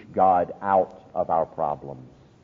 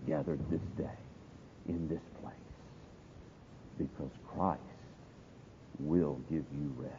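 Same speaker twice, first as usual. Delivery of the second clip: soft, earnest closing invitation; subdued pulpit close; hushed preaching appeal; 6 dB quieter than usual. Real, not acted.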